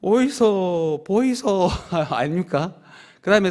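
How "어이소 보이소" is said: slow Busan market call; Busan dialect. Retold or imitated. Pastor recasting the sermon title in Busan dialect, slow market call. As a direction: drawl each word like a Busan vendor. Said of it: "부산 말로 하면".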